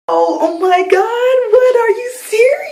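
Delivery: very high, slow, loud fake crying; hammed-up tearful disbelief; mock overwhelmed wailing. Retold overwhelmed reaction to bill being paid.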